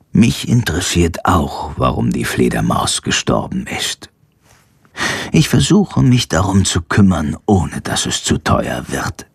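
a gentle old animal doctor, lightly voiced; kindly old vet.

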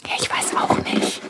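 hushed whisper while hiding. Acted. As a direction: whisper so the mouse won't hear. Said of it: "wir verhalten uns ganz ruhig".

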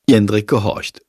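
ordinary delivery, nothing marked

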